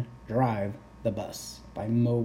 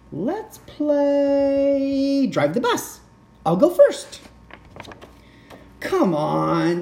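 high, slow pleading voice; wheedling pigeon; 9 semitones higher, 6 dB louder than usual. Acted. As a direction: sweet-talk for the wheel.

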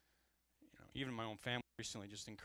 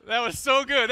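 words pushed out through loud, high laughter; loud, high laughing praise of his wife's joke; laughing loudly; 11 semitones higher, 19 dB louder than usual. Real, not acted. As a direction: still cracking up, loud and high.

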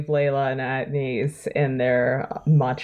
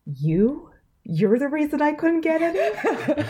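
betrayed; 11 semitones higher, slower than usual. Acted. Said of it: "look of absolute betrayal".

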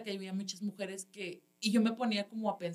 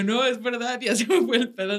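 higher, louder, laughing confession; giggling because you forgot the routine; laughing admission; 3 semitones higher, 8 dB louder than usual. Retold or imitated. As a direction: laugh while admitting you blanked.

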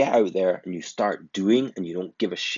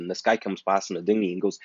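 ordinary talk, nothing marked

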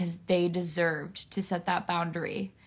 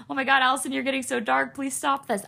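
higher-pitched mock plea; imitated worried listener; 6 semitones higher, faster than usual. Speaker voicing viewers who find it too dark, mock alarm. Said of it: "I know it sounds like".

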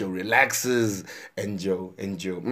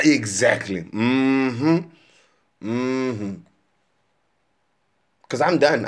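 ordinary delivery

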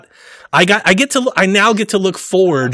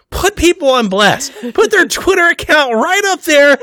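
laughing; 11 semitones higher than usual. Real, not acted. Laughing about developers reading mean tweets.

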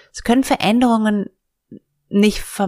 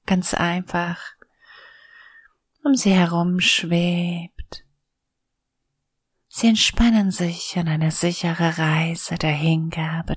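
soothing spa whisper. Acted. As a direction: guide them gently into calm. Leads a guided meditation.

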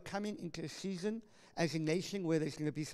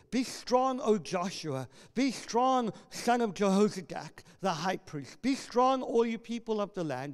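rally the hearers with each line; ringing preacher voice; preached scripture reading; 3 semitones higher, 5 dB louder than usual. Acted.

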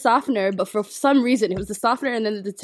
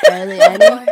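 much higher, louder squeak on barely; squeaky emphasis; 12 dB louder than usual. Real, not acted. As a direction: squeak out how tiny it was. Exaggerating a tiny amount.

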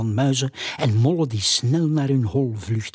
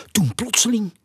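sudden suspense; 10 semitones higher, faster than usual. A narrator's reading. Sudden shadow appears in story.

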